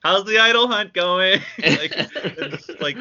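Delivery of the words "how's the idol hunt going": lightly mocked, loud called-out question; called-out yell. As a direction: call across teasingly, half-shouting. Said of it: "yells over to him".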